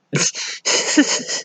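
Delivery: high breathless unvoiced giggle. Real, not acted. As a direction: laugh at your own joke. Laughs at own joke.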